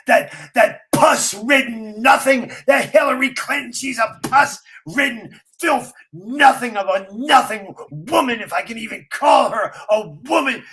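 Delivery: loud, furious rant. Retold or imitated. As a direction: spit it out in a rage. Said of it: "Gerald Celente gets going".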